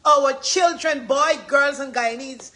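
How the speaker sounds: loud, high prophetic proclamation; ringing, emphatic revival-preacher delivery; voice of God. Acted. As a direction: preach it like a divine command. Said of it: "This is God saying".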